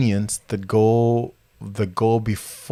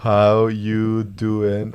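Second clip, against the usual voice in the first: very slow, drawn-out words; slow dictation; much slower than usual. Acted. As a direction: stretch each word as if writing it down. Spells out the line while writing.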